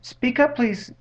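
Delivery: high, loud storybook call. A narrator's reading. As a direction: call out to someone hard to hear. Horton calls to a faint voice.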